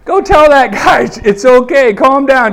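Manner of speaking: exasperated disciples. Retold or imitated. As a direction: call it out like a weary helper. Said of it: "like, going".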